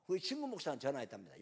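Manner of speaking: ordinary delivery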